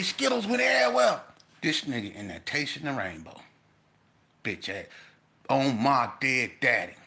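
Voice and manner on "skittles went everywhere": emphatic reveal. Retold or imitated. Inmate delivers the punchline.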